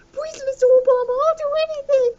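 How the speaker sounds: very high, pleading squeak; meme voice. Retold or imitated. Meme creature begging Mister Obama, very high and pleading. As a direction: squeak out a desperate plea. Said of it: "It's like".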